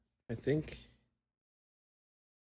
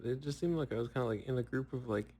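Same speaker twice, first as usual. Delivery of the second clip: ordinary delivery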